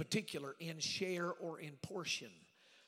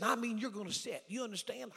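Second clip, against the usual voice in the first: higher, pressing retold command; insistent order; 5 semitones higher, 5 dB louder than usual. Bishop making sure the preacher will sit, insistent. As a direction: act out a bishop driving it home.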